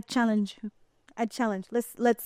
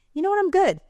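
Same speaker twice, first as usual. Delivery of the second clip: breezy refusal; 11 semitones higher, 4 dB louder, faster than usual. Retold or imitated. Quoting her own firm refusal.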